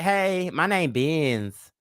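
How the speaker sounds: slow lisping "Benz" for "Bends"; drawn-out "my name Benz" with a lisp. Retold or imitated. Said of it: "'cause he had a lisp".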